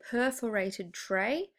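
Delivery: slow, careful "perforated tray", each syllable spelled out; careful enunciation. Real, not acted. Teacher forcing out a word she hates saying, slowly. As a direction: pronounce "perforated" deliberately, syllable by syllable. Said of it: "oh, I hate saying this word".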